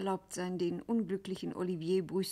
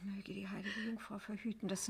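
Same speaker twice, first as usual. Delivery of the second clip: whispering; 11 dB quieter than usual. Acted. Scuderi's dark warning.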